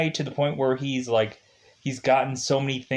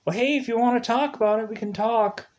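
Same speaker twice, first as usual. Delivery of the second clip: imitating a dad, higher and fast; gentle dad; 10 semitones higher, faster than usual. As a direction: coax a scared son like a kind father. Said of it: "He's like".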